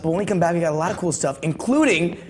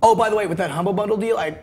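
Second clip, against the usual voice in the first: ordinary talk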